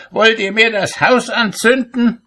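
very high-pitched, indignant cry; shouted reproach. A narrator's reading. Cobbler to wife lighting the morning fire, shrill indignant shout. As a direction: shriek it as an outraged accusation. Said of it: "schrie er".

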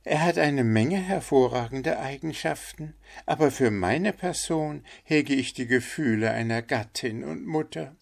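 high, put-on duck voice; matronly duck. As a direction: give a verdict in a high, motherly-duck voice. Figure of the duck. (mütterliche Ente, hohe verstellte Stimme)

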